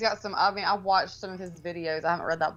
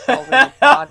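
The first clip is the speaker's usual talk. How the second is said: laughing; 6 semitones higher, 15 dB louder than usual. Real, not acted. Teasing jab at a friend.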